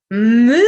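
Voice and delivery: loud mooing cow; loud playful moo. Shown as a demonstration. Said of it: "What sound does a cow make?".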